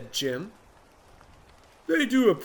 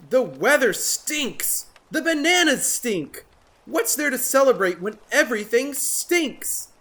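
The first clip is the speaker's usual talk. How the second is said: higher, louder grumpy snap; snapped; 9 semitones higher, 4 dB louder than usual. Acted. Jim snapping at his friends, grumpy. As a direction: spit out every complaint. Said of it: "snapped Jim".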